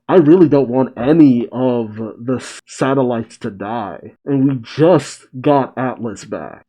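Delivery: usual speaking voice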